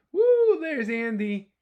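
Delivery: high, loud, slow cheer; excited cheer. A narrator's reading. Storyteller cheering as Andy surfaces, high loud whoop.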